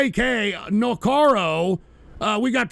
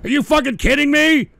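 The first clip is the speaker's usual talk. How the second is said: louder and higher, fed up; exasperated outburst; 5 semitones higher, 6 dB louder than usual. Real, not acted. Streamer snapping at chat's demand, an exasperated yell. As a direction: blurt it out in disbelief.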